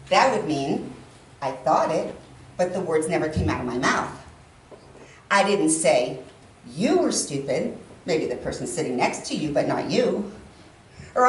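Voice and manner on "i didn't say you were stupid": pause, then "you" punched and stretched; stress on YOU. Shown as a demonstration. Speaker means someone next to you, stress on "you". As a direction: set "you" apart and hit it. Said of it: "emphasis on each one of them individually".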